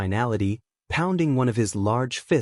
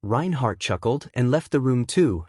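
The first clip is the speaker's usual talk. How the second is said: plain reading voice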